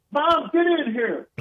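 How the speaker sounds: high, slow mock call to the kitchen; calling mom. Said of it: "He goes".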